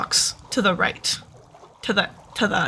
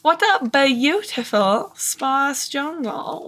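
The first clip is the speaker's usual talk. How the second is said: slow dreamy admiration; 3 semitones higher, much slower than usual. Pretend. Repeats admiring line, drawn out.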